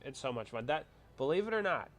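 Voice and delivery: ordinary talk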